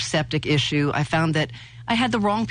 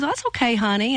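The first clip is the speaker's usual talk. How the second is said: higher, soothing neighbor voice; neighbor Carol; 5 semitones higher than usual. Retold or imitated. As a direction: reassure her gently, pitched up. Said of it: "And she goes".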